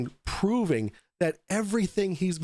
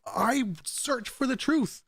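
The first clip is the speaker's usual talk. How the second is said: higher, slow, self-important; mock solemn truther; 8 semitones higher, slower than usual. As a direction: intone it like a grand claim. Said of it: "He says".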